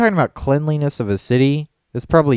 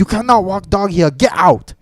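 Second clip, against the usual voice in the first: mocking a rude worker's broken English, loud and higher; higher, louder retold order in broken English; rude park worker; 9 semitones higher, 5 dB louder than usual. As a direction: bark it like a curt guard shooing you off. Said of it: "he seriously said".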